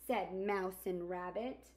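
gentle storybook narration; reading to children. Acted. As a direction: read it like storytime for kids. Reads picture book aloud.

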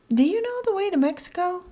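fast and high, rushed question; a chatty butterfly in a hurry; quick, high-pitched question; eager butterfly. Acted. Butterfly Bob asks directions.

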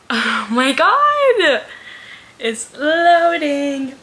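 nearly in tears with excitement; overjoyed cry. Real, not acted. Vlogger to viewers as her new Kindle loads, slow tearful joy.

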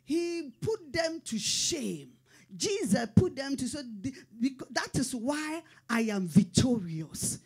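rising, ringing sermon delivery; full-force gospel preaching; fervent preaching. Acted. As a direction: preach it to the back row.

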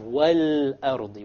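"wal-ardi" with a clear, held l; sounded lam in "wal-ardi"; lam pronounced. Shown as a demonstration. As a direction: linger on the l before the hamza in "wal-ardi". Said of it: "We pronounce the lam".

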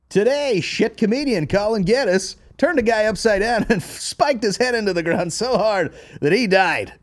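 high announcer voice reading a fake headline; mock news anchor. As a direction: play a breathless TV newsreader.

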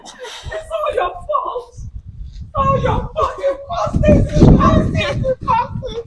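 high, crying accusation; mother's high, crying reproach; tearful blame. Real, not acted. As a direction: hurl the blame through tears. Mother blaming him, crying.

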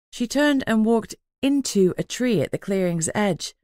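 ordinary delivery, nothing marked

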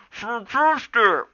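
talking through a mouthful; mouth full. A narrator's reading. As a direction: say it round a bite of food. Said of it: "mumbled Firepaw, his mouth still full".

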